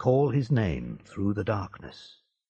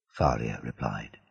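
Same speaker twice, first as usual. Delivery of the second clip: usual speaking voice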